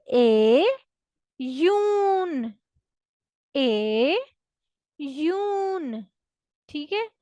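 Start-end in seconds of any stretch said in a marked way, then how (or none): 0.1-2.5 ए then a long rising यून; Russian iyun. Shown as a demonstration. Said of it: "जून को कहते हैं". 3.6-6.1 ए then a long rising यून; Russian iyun. Shown as a demonstration. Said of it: "जून को कहते हैं".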